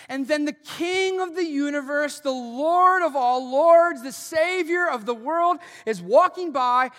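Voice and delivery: high, loud, building proclamation; loud swelling sermon build; preaching at full force. Acted. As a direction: proclaim his titles like a herald. Preaching climax.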